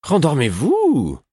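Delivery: slow, high and loud irritated order. Acted. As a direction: snap at him to go back to sleep. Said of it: "d'un ton irrité".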